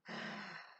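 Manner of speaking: sharp delighted intake of breath; joyful gasp. A narrator's reading. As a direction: gasp with sheer joy. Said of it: "she sucked in her breath for sheer joy".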